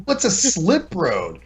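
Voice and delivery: a mildly mocked wife, lost at the British term; confused wife. Wife hears a strange road term from navigation, confused. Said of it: "She's like".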